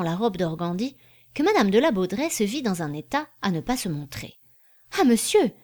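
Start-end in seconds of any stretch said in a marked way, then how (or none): 5.0-5.5 raised, louder haughty protest; majestic exclamation. A narrator's reading. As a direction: exclaim grandly, pitched up. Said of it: "s'écria majestueusement Dinah".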